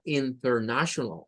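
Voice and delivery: "international" with the T pronounced. Shown as a demonstration. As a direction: keep the T in "international". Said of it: "más fácil pronunciar la T".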